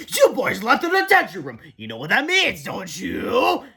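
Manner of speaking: high, loud gloating scold; stern principal. Acted. Mister Crump scolding the boys in a high, loud gloat. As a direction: lord it over them.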